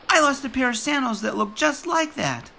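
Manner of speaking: put-on high, childlike boy voice; eager small kid; little boy.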